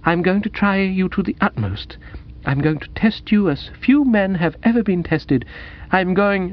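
high, earnest heroine's voice; soulful young woman, solemn. Acted. Woman warning Bertie of a great test, high and solemn.

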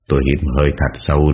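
plain reading voice

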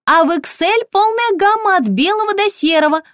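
very high, chirpy shop assistant; high-pitched, ringing sales voice; ringing saleswoman voice. A narrator's reading. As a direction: pipe up brightly through the door. Ringing-voiced saleswoman lists colours.

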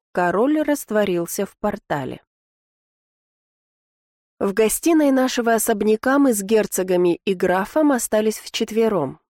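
ordinary delivery

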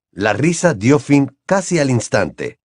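usual speaking voice

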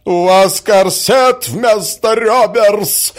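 high, loud, drawn-out taunt; spiteful added jibe. A narrator's reading. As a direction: pile on, loudly and spitefully. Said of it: "прибавила Наталья Дмитриевна". (ехидно и громко прибавить)

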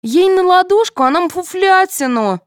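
high pouting complaint; sulky child, pitched high. A narrator's reading. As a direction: pout it out like a sulking girl. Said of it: "надулась Соня".

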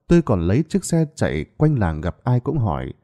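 usual speaking voice

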